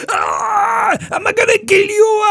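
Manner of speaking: high loud sobbing screech; crying shriek. Retold or imitated. Pinned boy with knife threatens the kids, sobbing high screech. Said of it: "he's going".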